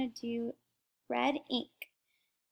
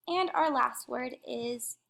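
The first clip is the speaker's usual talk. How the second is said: ordinary talk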